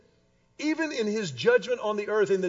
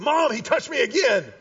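put-on whiny kid yelling; 6 semitones higher, 7 dB louder than usual. Kid tattling from back seat.

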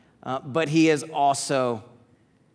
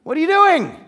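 much higher, much louder shout; Jesus shouting; 13 semitones higher, 12 dB louder than usual. Retold or imitated. Jesus rousing sleeping disciples in the garden, loud shout. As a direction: jolt them awake with a sharp shout. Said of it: "he's like".

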